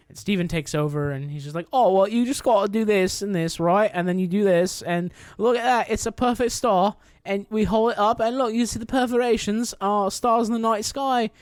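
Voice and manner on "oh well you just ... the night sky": put-on Steven's chirpy British accent, pitched higher; a light London lilt, eager to explain. To Layla over the star map, a light British accent. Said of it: "[name]'s British accent".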